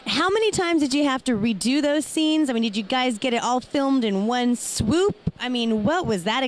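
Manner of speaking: normal talking voice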